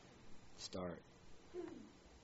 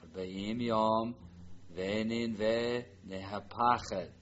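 slow read-through; much slower than usual. Shown as a demonstration. Teacher repeats Jonah's message for the class, slow and calm. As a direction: say it slowly and steadily. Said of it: "Now the line. Jonah's message".

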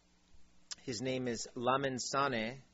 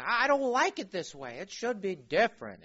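higher-pitched imitated griping; mock complainer; 7 semitones higher, 4 dB louder than usual. Some people disliking the church culture, mock complaining voice. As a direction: put on a disgruntled churchgoer's complaint. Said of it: "they even say it like that".

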